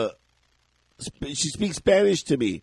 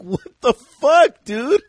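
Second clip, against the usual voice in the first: angry disbelief; 8 semitones higher, 5 dB louder, much slower than usual. Retold or imitated. Confronting the driver.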